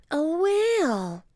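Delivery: put-on high, slow little girl voice; a kid revealing a surprise; excited girl. Annie tells Jack, high and slow.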